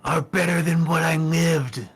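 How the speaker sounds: loud, high-pitched hollered jeer; screaming taunt. Acted. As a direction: yell it over the wall at your enemies. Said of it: "at the top of his lungs screaming".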